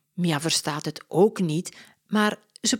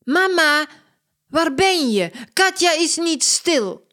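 higher, louder complaining call; calling mom; 10 semitones higher, 6 dB louder than usual. Acted. Mia calls Mama to complain about Katja, whiny. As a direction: call out to mother for help.

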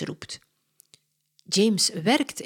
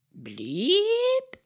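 a much higher "Bliep" like an electronic beep; imitating a lift beep. Detective copies the parrot's lift beep, high. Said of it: "Meneer Holmes doet de geluiden na".

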